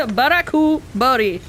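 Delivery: Barakubori said as Japanese "ba-ra-ku-bo-ri"; Barakubori, every syllable even, r tapped; Japanese loanword. Shown as a demonstration. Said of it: "one of my favorite words in Japanese is blackberry".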